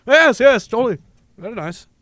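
put-on jolly posh accent, fading away; fake fancy accent. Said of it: "with our fake accents".

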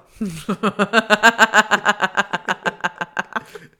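long loud laugh. Real, not acted.

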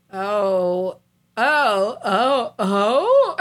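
hammed-up flustered boyfriend; imitating a flustered dude. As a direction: build the flustered ohs up the scale. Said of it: "is just like".

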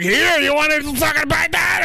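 high, loud pushy offer; mock shout. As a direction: yell it at the kid, grinning. Parent pushing lobster on child.

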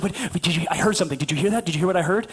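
low, stammering insistence; imitated anxious wife. Wife insisting she heard something, low imitated fretting. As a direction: press the question in a low voice. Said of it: "And she's like".

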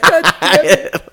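high, slow curse through laughter; laughing exasperation. Real, not acted. Reacting to the friend's jab about the joke, real laughing curse. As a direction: curse while cracking up.

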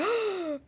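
sharp high gasp; shocked gasp. Retold or imitated.

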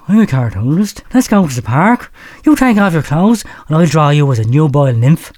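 usual speaking voice

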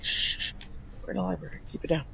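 librarian shushing whisper. Acted.

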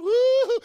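high, loud mock bird whoop; crow cry. Preacher imitating a crow calling in the cold, high whoop. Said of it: "there's a crow going".

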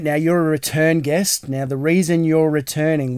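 ordinary talk

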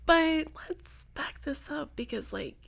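slow, hushed whisper. Retold or imitated. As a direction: whisper it slowly, conspiratorially. Retold reply to a friend.

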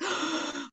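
a sharp, quiet gasp of panic; short startled intake of breath; retold gasp. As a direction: gasp in sudden alarm as you retell it. Stress level going up.

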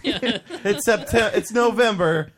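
high, loud laughing outburst; laughing exclaim. Real, not acted. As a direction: burst out through laughter. Laughing protest about Taco Bell.

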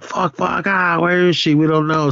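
high-pitched mock alarm; mock panic. Retelling friends losing Liz through trap door, mock panic.

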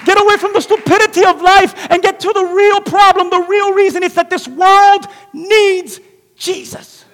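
loud, high, driving sermon voice; full-force preaching. Acted. Pastor preaching to the congregation, full force.

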